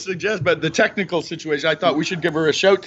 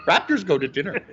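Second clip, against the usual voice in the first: ordinary talk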